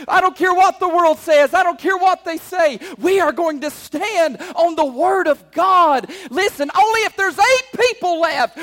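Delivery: loud, rising, fervent sermon; full-force preaching. Acted. Preacher rallying the congregation to stand firm, loud and fervent. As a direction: drive it home to the congregation.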